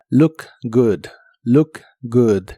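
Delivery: "Look good" with a short, punchy u in both words; "look good" con u corta y explosiva; short explosive u. Shown as a demonstration. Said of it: "como si fueran una U corta y explosiva".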